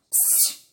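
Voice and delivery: soft, quick shushing hiss; quick hushing psst. A narrator's reading. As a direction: hush her quickly. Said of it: "fez o coelho baixinho e bem depressa". (psiu baixinho e rápido)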